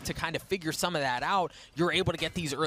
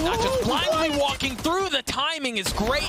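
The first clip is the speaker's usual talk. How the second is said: screaming hype, much higher, louder; esports caster; 14 semitones higher, 5 dB louder, slower than usual. Real, not acted. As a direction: lose it over the play. Caster hyped on Navera play.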